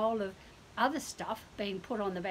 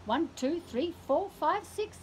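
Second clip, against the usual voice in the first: ordinary delivery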